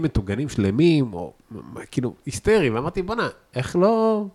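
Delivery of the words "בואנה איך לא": amazed exclamation. Retold or imitated. Retold amazed exclamation at food.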